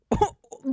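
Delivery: a sudden high incredulous laugh; burst of laughter. Real, not acted. Laughing at her dad's one-week proposal, real laugh. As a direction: laugh out loud in disbelief. Laughing at parents' whirlwind engagement.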